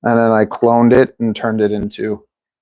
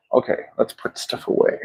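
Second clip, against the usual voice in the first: whispering; 9 dB quieter than usual. Real, not acted. Muttering to herself, tidying up.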